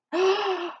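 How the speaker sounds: a short surprised gasp; mock gasp. Teacher gasps at the picture for the children, a mock gasp. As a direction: gasp as if spotting something. Teacher reacts to hidden dinosaurs.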